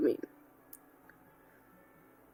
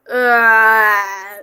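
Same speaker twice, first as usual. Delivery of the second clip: loud anguished ah; pained loud groan; 9 dB louder than usual. Real, not acted. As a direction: groan out in dismay.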